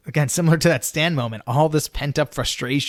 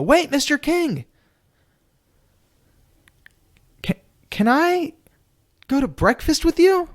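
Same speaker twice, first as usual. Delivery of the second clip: desperate plea; 13 semitones higher than usual. Retold or imitated.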